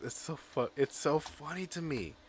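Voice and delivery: ordinary talk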